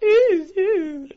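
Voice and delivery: very high, loud mock Queen's English; mock posh English. Mocking how Americans hear him, high posh English. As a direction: hoot like a posh Brit. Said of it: "Queen's accent".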